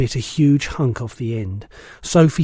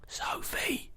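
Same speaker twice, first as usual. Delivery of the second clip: high, soft whisper; 14 dB quieter than usual. A narrator's reading. BFG softly calling for hidden Sophie, whispering. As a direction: whisper her name gently. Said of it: "he whispered".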